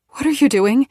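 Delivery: rising, frightened question; fearful. A narrator's reading. Narrator, trapped by Nola, asks fearfully. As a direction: ask it scared. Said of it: "I ask fearfully".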